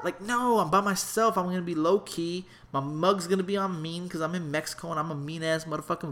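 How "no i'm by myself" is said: ordinary talk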